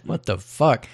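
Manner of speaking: normal talking voice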